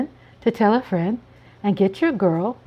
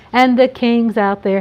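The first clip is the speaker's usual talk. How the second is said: ordinary talk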